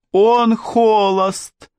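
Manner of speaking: high, slow, amazed exclamation of the king; exclaiming. A narrator's reading. King telling the ladies, high drawn-out astonished cry. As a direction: stretch it out in delight, far up high. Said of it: "воскликнул король".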